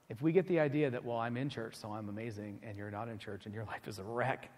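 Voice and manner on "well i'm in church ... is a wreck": smug churchgoer. Retold or imitated. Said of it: "that's not the right attitude".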